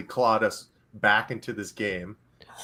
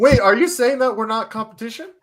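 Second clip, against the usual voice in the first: high, loud mock outrage; mock offended; 13 semitones higher, 6 dB louder than usual. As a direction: play it fake insulted.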